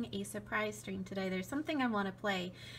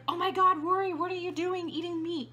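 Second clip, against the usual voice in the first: shocked viewer; 7 semitones higher, 5 dB louder than usual. Pretend. Imagined viewer shocked reaction.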